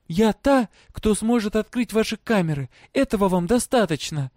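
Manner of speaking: high-pitched, cheeky retort; defiant. A narrator's reading. Girl answering a prisoner's challenge, defiant. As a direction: answer them boldly, in a high voice. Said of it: "дерзко ответила она".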